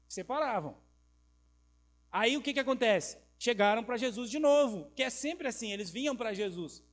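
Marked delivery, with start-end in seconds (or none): none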